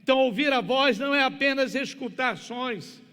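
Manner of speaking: loud, high, fervent sermon delivery; full-force preaching. Real, not acted. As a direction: preach it hard in driving, urgent phrases.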